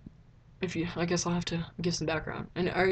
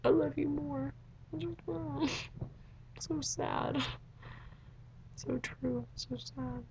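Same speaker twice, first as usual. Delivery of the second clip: soft, higher, sighing swoon; sappy swoon; 8 semitones higher, 7 dB quieter, much slower than usual. Real, not acted. Sappy fan swooning over a book line, soft and high. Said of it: "my sappy girliness coming out".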